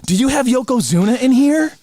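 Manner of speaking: high, excited fanboy question; eager fan. Retold or imitated. Guard thrilled by the toys, retold.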